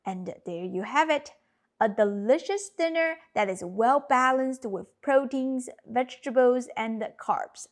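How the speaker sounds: usual speaking voice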